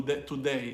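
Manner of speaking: today said "too-DAY", unreduced first syllable; overdone "too-day"; full oo vowel. Shown as a demonstration. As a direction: keep a pure oo in the "to" of today. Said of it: "No one says the oo vowel".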